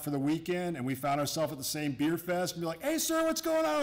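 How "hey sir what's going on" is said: much higher, chirpy fake-friendly hello; overly cheerful greeting. At the beer fest he greets someone he can't place, chirpy and much higher. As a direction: breezily greet a man whose name you've forgotten.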